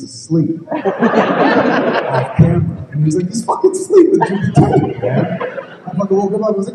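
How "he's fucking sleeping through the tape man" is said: high-pitched, laughing through the words. Retold or imitated. Chris Rock cracking up at the sleeping actor, laughing high. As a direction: squeal it out, cracking up.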